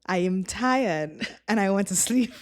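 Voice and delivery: ordinary talk, nothing marked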